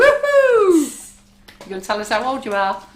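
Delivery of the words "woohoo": high, loud whoop; excited cheer. Real, not acted. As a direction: cheer at the top of your voice. Cheer after birthday song.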